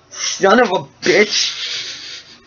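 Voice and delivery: high, long drawn-out curse; hammed-up old geezer in agony; pained old man cursing. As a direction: stretch the swearing out in high-pitched pain. Old man cursing after being hit.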